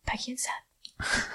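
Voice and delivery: breathless starstruck whisper; hushed, starstruck. Retold or imitated. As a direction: whisper it in awe. Starstruck whisper on seeing idols.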